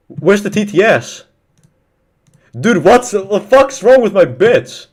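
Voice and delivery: high, loud complaint; exasperated shouting. Real, not acted. Streamer demanding what's wrong with the bits, exasperated shout. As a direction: yell it high, dragging the words.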